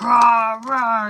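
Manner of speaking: loud playful growl of rage; hammed-up anger noise; mock angry roar. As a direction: bark it out like a cartoon tantrum. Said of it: "that anger stage".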